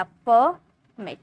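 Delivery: परमिट very slowly as "per", long pause, "mit"; split into syllables. Shown as a demonstration. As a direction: break परमिट into two separate beats. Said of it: "ये दो बार sound आया".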